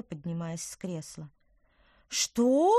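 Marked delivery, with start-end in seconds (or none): 2.1-2.7 shocked, loud high yelp; astonished yelp. Acted. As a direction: yelp in disbelief. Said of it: "изумлённо завопил Тыквер".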